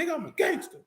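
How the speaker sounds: high mock tough boast; mock gangster boast. Teasing his partner about acting tough. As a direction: ham up a gangster brag.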